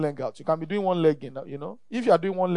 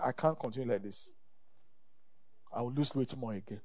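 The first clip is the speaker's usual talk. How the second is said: muttered vow to self; 7 semitones lower, 15 dB quieter than usual. Retold or imitated. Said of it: "I said".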